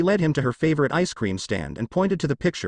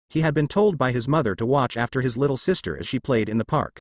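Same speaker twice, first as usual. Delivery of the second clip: plain reading voice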